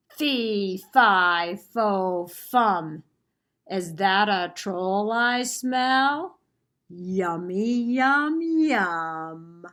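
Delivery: loud, slow, hungry giant chant; booming giant. Acted. Giant smelling the troll under the bridge, loud and slow. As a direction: boom each word like a giant sniffing prey.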